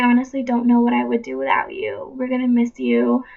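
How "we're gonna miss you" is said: usual speaking voice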